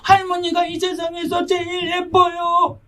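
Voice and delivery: put-on a hurt little girl on the verge of tears; tearful child. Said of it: "울먹거리면서 나가는".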